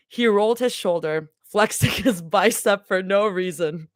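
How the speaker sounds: low, steady read-aloud of a fanfic chapter; story narration. A narrator's reading. Streamer reading a fanfic chapter to chat, low and steady. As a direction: read it like a cozy audiobook.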